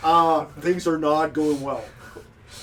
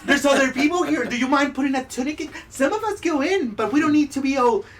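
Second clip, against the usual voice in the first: much higher, fussy and indignant; prim scold; 9 semitones higher, 5 dB louder, faster than usual. Acted. Bather scolding naked strangers, much higher and prim. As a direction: scold them like a prudish matron. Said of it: "bath lady scolds".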